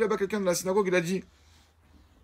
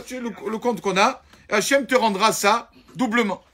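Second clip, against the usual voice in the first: raised, louder, earnest appeal; a heartfelt plea from a friend, raised and loud; pleading letter voice; 5 semitones higher, 6 dB louder than usual. Acted. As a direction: read the Rav's letter like an urgent request. Rabbi's letter read out as a plea.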